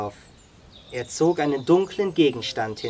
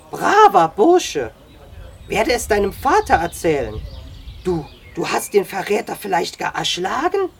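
amazed exclamation; 3 semitones higher, 6 dB louder than usual. A narrator's reading. Sam hearing of the boy's feat, astounded. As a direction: rave in astonishment, pitch leaping high.

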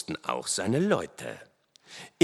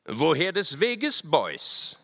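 high, slow questioning officer; officer lightly voiced, drawing the words out; stern officer; 7 semitones higher, slower than usual. A narrator's reading. Officer challenges four riders on the trail, stern. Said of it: "mit finsterem Blicke und fragte dann".

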